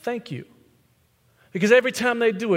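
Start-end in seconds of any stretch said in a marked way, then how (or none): 0.0-0.5 soft thanks. Shown as a demonstration. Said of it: "or if they say".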